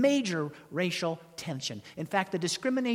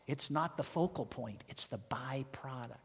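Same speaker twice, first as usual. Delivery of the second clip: hushed whisper, softer; soft secret aside; 5 semitones lower, 7 dB quieter than usual. Real, not acted. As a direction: lean in and whisper the point. Whispered point.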